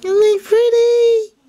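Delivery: high, loud squeal of admiration; delighted cooing. Real, not acted. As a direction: squeal over something pretty. Admiring the dress forms.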